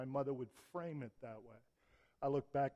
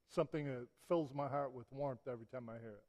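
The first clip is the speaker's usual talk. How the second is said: ordinary talk, nothing marked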